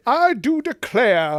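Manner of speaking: high, loud, slow mock Southern-British accent; British-Georgian accent. Co-host mocks actor's accent, British slash Georgian. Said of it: "doing a British slash Georgian accent".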